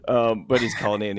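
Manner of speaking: normal talking voice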